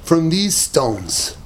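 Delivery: very slow, weighty reply; solemn, slow. Acted. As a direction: let each word land slowly. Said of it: "You know what he said?".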